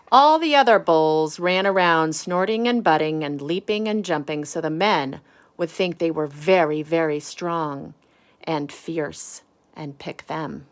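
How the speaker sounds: lively picture-book storytelling; reading to children. Acted. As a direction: read it to the kids, expressive and clear.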